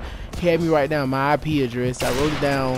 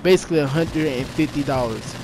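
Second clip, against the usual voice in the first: ordinary talk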